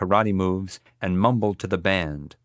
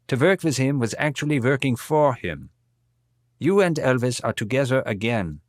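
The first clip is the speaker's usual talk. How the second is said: put-on reads the quote in a heavy German accent, pitched up; a mourning old man with a heavy German accent; German accent, voice raised; 6 semitones higher, 3 dB louder than usual. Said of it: "in his heavy German accent".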